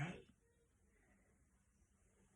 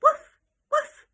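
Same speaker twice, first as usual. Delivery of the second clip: much higher, louder barking woof; playful yappy woof; dog bark; 12 semitones higher, 8 dB louder than usual. Shown as a demonstration. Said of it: "This animal makes the sound".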